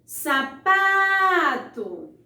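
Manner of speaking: "Sapato" called out loud, PA held very long; calling from afar. Shown as a demonstration. Teacher calls a faraway word to find its stressed syllable, "Sapato" with long pa. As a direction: call "Sapato" as if it were far away, stretching pa. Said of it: "a sílaba que eu mais demorei pronunciando".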